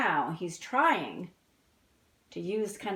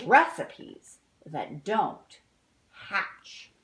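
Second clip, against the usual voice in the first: storybook reading; 3 semitones higher, 4 dB louder than usual. A narrator's reading. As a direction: read it like a picture book to kids. Reads the book ending.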